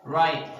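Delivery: loud "Right" cut off at the T; unreleased T in "Right"; stop T. Shown as a demonstration. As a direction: swallow the final T in "Right". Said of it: "they stop".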